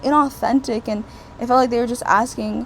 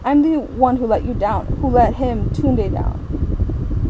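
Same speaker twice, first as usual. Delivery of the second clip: usual speaking voice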